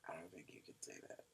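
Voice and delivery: hushed quick whisper. Real, not acted. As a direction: whisper it as a warning. Whispered warning.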